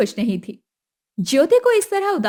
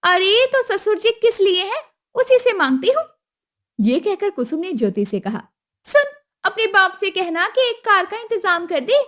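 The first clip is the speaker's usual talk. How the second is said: higher, pushy demand; demanding mother-in-law; 8 semitones higher, 3 dB louder than usual. Acted. Kusum demanding the dowry at the wedding, high and pushy. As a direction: ask for the money like it's owed.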